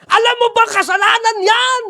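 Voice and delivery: loud, high scolding shout; preacher mock-shouting a rebuke at a new believer; harsh rebuke. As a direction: snap at them accusingly.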